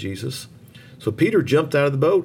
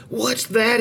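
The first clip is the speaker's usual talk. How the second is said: high frightened cry of alarm; startled high scream of fear; 11 semitones higher than usual. A narrator's reading. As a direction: shriek it in sudden fright. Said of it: "he screamed".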